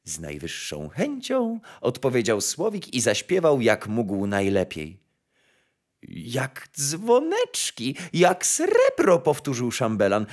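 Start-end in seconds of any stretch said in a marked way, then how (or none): 0.0-1.6 soft, low, polite bird; gracious nightingale. A narrator's reading. Nightingale agreeing to sing for the emperor, soft graciousness. 6.1-9.2 high, slow, drawn-out admiration; enraptured chamberlain. A narrator's reading. Chamberlain praising the nightingale's song, slow high rapture.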